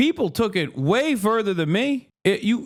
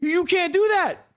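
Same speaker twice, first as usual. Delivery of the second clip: mock outraged elite; 8 semitones higher than usual. Mocking the powerful reacting to the CEO shooting, in a high alarmed mock voice. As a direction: gasp it out like a scandalized aristocrat defending his lord. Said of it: "they're like".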